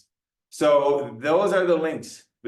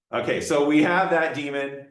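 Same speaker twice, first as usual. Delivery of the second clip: ordinary talk, nothing marked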